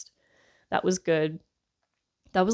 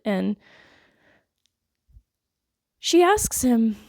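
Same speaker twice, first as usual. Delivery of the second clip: usual speaking voice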